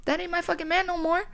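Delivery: high, indignant declaration; sassy high-pitched dismissal; defiant outburst. Real, not acted. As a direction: fling it out, done with him.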